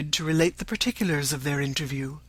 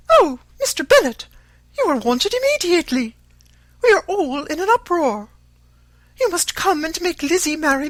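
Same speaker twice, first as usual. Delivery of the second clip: much higher, louder urgent call; 13 semitones higher, 9 dB louder than usual. A narrator's reading. To her husband in the library, a loud high call. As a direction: call across the library in a fluster. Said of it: "called out as she entered".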